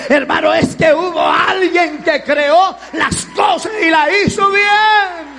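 loud, driving preacher cadence; loud, rousing sermon delivery; fiery preaching. Acted. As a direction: preach it to the congregation full force. Preaching at full volume.

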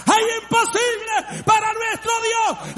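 high, loud triumphant cry; triumphant full-voice shout; shouted climax. Acted. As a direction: explode the declaration at the peak. Climax of repeated declaration.

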